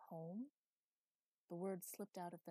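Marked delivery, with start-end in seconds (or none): none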